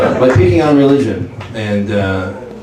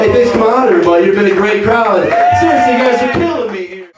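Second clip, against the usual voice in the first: higher, upbeat club-comic patter; stand-up comic Jesus; 8 semitones higher than usual. Retold or imitated. Jesus on the cross opening his comedy set. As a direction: work the room like a cheesy headliner. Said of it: "ultimate stand-up comedian".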